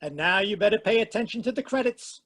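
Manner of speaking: usual speaking voice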